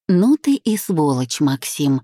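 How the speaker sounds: plain reading voice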